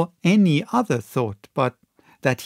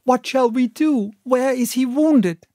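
1 alarmed plea; 10 semitones higher, 4 dB louder than usual. A narrator's reading. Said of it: "we cried".

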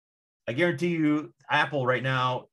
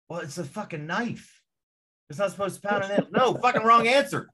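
higher, louder scornful retort; mock dismissive boss; 9 semitones higher, 4 dB louder than usual. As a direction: scoff at the complaint like a clueless boss. Boss rejecting field complaint mockingly.

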